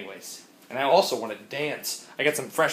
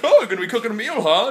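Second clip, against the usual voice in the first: friendly stranger; 8 semitones higher, 6 dB louder, faster than usual. Retold or imitated. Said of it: "And this guy's like".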